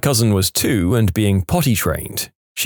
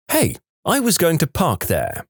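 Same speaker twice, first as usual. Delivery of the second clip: higher, whiny complaint; a lightly voiced indignant woman; entitled lady; 7 semitones higher than usual. Harpy lady leaning out her car window, higher indignant whine. Rude lady claims parking spot.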